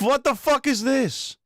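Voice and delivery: very high, loud, drawn-out squawk; startled high outburst. Real, not acted. Streamer balking at what popped up on screen, startled outburst. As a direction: shriek it in sudden disbelief.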